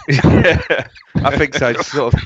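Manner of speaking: laughing yeah. Real, not acted. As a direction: laugh through the word. Laughing at joke.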